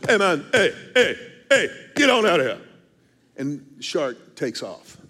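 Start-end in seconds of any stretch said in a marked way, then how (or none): 0.1-2.6 retold shooing shout. Guide chasing the shark away from the angler, imitated shout. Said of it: "When the guide comes over and stomping".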